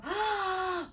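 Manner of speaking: high, drawn-out "aah"; hammed-up cry of alarm; mock panicked scream. Wondering how to leave the house, acted panic.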